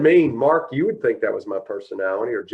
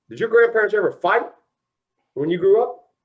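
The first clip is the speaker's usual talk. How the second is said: mock stern father; 11 semitones higher, 6 dB louder than usual. Father grilling him, retold.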